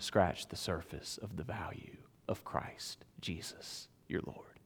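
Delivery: real whispered sermon emphasis. Real, not acted. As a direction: whisper it as if sharing a secret. Preacher drops to a whisper.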